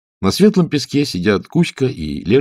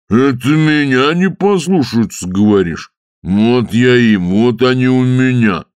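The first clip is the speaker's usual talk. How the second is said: slow, loud threatening; threaten, offended; angry water spirit; 4 semitones higher, 4 dB louder, slower than usual. Acted. (грозный голос Водяного)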